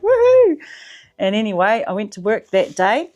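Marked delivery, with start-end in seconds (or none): 0.0-0.6 loud high whoop; mock cheer. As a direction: whoop it up in celebration. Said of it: "I was like".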